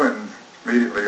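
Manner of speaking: normal talking voice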